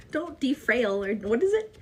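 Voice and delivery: ordinary talk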